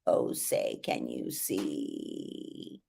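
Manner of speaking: a croaking chain-smoker voice; super exaggerated fry. Shown as a demonstration. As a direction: drag it out in deep creaky fry. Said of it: "I'm super exaggerating that".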